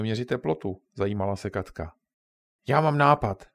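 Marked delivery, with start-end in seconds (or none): 2.7-3.5 Ondra blurts out his idea loudly; excited cry. Acted. Boy shouting his idea to friends, loud. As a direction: call it out, bursting with the idea. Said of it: "vykřikl Ondra".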